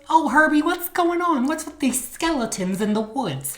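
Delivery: high-pitched put-on wife voice; oblivious wife. Wife asking Herbie about skeletons, mock-naive questioning.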